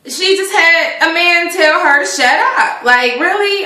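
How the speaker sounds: high, incredulous complaint; exasperated disbelief; indignant outrage. Real, not acted. Indignant about the insult.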